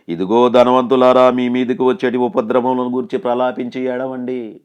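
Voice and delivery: thundering pulpit reading; proclaimed scripture. Acted. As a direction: declaim the verse like a prophet. Preacher proclaims scripture verse loudly.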